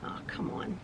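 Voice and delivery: breathy exasperated whisper; whispered oh come on. Real, not acted. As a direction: whisper it in frustration. Thread tangles again.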